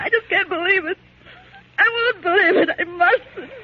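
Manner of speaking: high, tearful protest; crying out in disbelief; tearful denial. Acted. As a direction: fight back sobs as you deny it. Refuses to believe Hans killed uncle.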